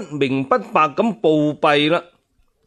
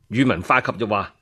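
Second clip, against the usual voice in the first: ordinary delivery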